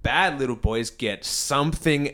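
slow, deliberate mock nun's warning; vague adult threat. Head nun to the bad kid, slow veiled threat. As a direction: draw out each half as a veiled threat. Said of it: "just a vague adult threat".

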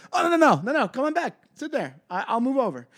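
high, eager calling back; eager pleading. Retold or imitated. Comic to the couple, high pleading to come back. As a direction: chase them down, begging them to sit.

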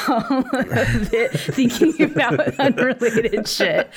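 laughing through the words, pitch climbing; real laughter breaking up the sentence; cracking up. Real, not acted. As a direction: barely get it out for laughing. Cracking up at own scene idea.

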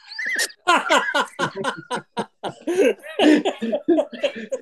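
long, high laughing fit; hearty laughter. Real, not acted. Friends laughing at [name]'s shirt story, long high laughter. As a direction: burst out laughing at the punchline. Laughter at his own punchline.

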